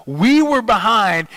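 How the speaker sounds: fan's outcry. Shown as a demonstration. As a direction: groan it out like your team is losing. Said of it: "talked about your team like that".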